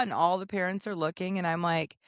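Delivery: normal talking voice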